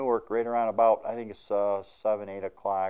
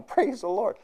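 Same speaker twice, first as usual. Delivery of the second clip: high-pitched cry of praise; yelling; 13 semitones higher than usual. Retold or imitated. Hitchhiker yelling out again while riding along, high cry. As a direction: shout it up to heaven. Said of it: "all of a sudden he's yelling".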